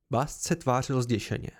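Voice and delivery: ordinary delivery, nothing marked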